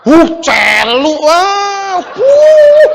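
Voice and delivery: high, loud laughing groans of disgust; mock disgusted groans. Preacher miming the stench for the congregation, loud disgusted groans. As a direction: retch and groan at the smell, hammed up.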